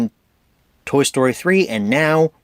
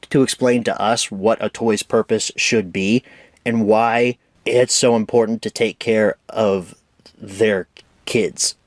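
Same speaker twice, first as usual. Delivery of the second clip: usual speaking voice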